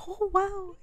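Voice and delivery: high, breathy little wow; impressed gasp. Retold or imitated. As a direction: react like it swept her off her feet. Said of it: "She was like".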